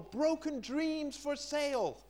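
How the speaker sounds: high, slow street-seller cry; market seller calling out. Pretend. Preacher imagines a market seller hawking wares, calling out. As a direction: hawk it like a market trader. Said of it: "imagine if it was a market seller standing there selling".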